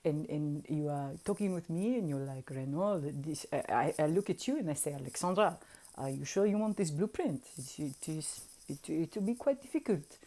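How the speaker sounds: quiet accented impression of an adviser; imitated wizard. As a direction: imitate a gently cautioning accented mentor. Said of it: "he was like".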